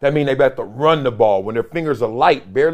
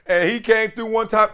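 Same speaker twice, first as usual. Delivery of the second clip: normal talking voice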